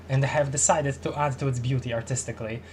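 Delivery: ordinary talk, nothing marked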